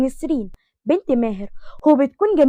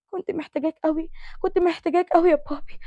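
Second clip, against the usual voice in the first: much softer, higher, near-tearful murmur; barely voiced, a daughter confiding her hurt; softly pleading; 4 semitones higher, 8 dB quieter than usual. Daughter whispering her need. (بنت تهمس لأبوها بصوت خافت حزين)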